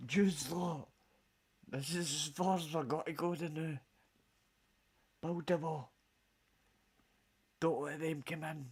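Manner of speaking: loud, hammed-up soldier barking orders about the wall; rally the troops behind the wall, loud and mocking; Roman guard. Roman soldier rallying his lot behind the wall, loud mock shouting.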